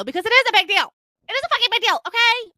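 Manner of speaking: very high, loud insistence; high-pitched indignant rant; hammed-up outrage. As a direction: throw an exaggerated fit. Hammed-up insistence on big deal.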